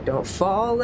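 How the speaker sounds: ordinary delivery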